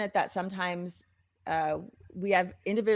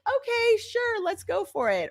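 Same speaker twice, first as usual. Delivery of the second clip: a bright, much higher okay; eager yes; 13 semitones higher than usual. Retold or imitated. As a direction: agree with cheerful gusto, light mock. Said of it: "You're willing to say".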